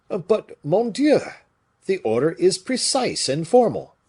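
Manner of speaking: high, loud, agitated objection; alarmed protest. A narrator's reading. Officer warns them the order is formal, alarmed protest. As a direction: protest urgently, pitch soaring.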